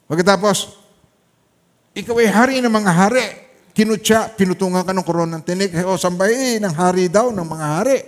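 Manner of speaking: high, loud preaching of the Passion; fervent preaching. Acted. Pastor preaches the crucifixion to his flock, fervent. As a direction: preach it to the rafters.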